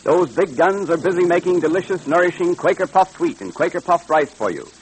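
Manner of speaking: normal talking voice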